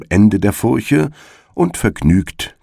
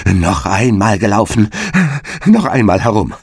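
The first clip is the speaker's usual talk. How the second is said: frightened cry; 4 semitones higher than usual. A narrator's reading. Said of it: "ganz außer sich vor Furcht und schrie".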